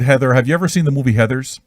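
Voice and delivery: ordinary talk, nothing marked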